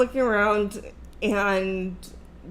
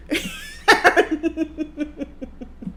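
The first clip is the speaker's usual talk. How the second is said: high giggle trailing away; long laugh; 7 semitones higher than usual. Real, not acted. Storyteller laughing at his salt outburst, long high giggle. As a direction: crack up helplessly at the punchline. Laughs at her own punchline.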